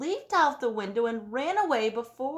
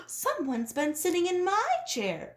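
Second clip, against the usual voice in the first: higher mama-bear cry, stress on my; 8 semitones higher than usual. A narrator's reading. Mama Bear finds her chair sat in, higher cry. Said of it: "cried Mama Bear".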